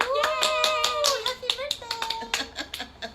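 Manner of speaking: high, loud delighted yay, then slow laughing birthday wish; excited cheer. Acted. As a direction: cheer like a thrilled child.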